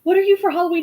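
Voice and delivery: high loud friendly ask; cheery host question. Retold or imitated. Host asking a kid about his costume, high and loud.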